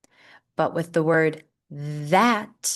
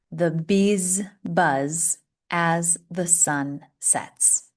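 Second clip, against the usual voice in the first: slow model reading; slower than usual. Shown as a demonstration. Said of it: "Repeat after me".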